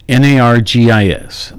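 N A R G I S said letter by letter; spelling out. Real, not acted. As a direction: spell N A R G I S aloud, each letter named. Spells out the uncertain name.

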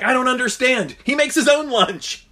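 high, loud disbelief; mock baffled outcry. German worker left looking around, mock baffled outcry. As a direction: wail the punchline in hammed-up bewilderment.

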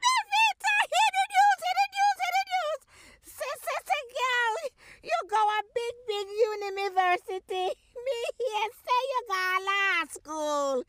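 high, loud calling out; mother shouting. Acted. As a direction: shout across the yard at her daughter. Mother shouting at daughter, Jamaican skit.

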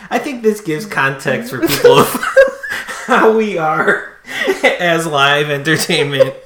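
laughing through the words; can't stop laughing while talking. Real, not acted.

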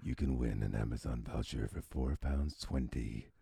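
seduce the listener with every word; sexy voice. Shown as a demonstration. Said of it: "can you do it in your sexy voice".

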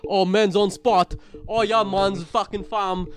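slow, loud mock London roadman; hammed-up London roadman voice; London slang. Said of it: "cool talk over there".

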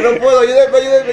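high, very loud mock pleading; hammed-up desperate cry for rescue; retold shout for help. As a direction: yell for help, hamming up the helplessness. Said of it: "Y grité, dije".

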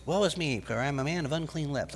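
quick, soft, offhand recital; casual, hurried mumble of scripture; expressionless reading. Shown as a demonstration. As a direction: toss it off softly without feeling. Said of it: "I don't think it's".

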